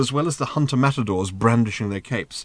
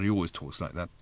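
muttered much softer, aside; 5 semitones lower, 11 dB quieter, faster than usual. A narrator's reading. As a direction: keep it under your breath. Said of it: "muttered Arthur".

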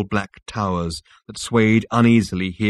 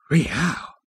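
higher, impressed exclamation; impressed, pitch swooping; much impressed; 7 semitones higher than usual. A narrator's reading. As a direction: let it out in mild awe. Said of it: "much impressed".

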